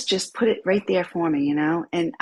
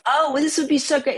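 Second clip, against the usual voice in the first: higher, louder eager imitation; a husband's overeager pitch, imitated; mock excited husband; 8 semitones higher, 5 dB louder than usual. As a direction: ape his eager enthusiasm. Husband's excited quote, mocked.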